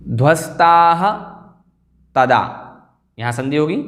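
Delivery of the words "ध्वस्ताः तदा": ध्वस्ताः slow and rising with "ha", pause, तदा; visarga sounded. Shown as a demonstration. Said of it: "विसर्ग आ जाएगा".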